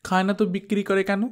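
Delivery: put-on fast, high argumentative child; quick retort. Daughter arguing with mother about the ice, fast and high. Girl argues back.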